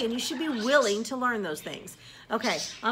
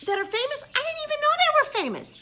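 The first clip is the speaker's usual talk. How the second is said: normal talking voice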